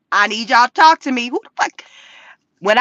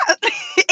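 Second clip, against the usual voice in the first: short, very high squeal of a laugh; 5 dB quieter than usual. Real, not acted. To viewers about Alexander, a very high squeal of laughter. Laughs mid retold reaction.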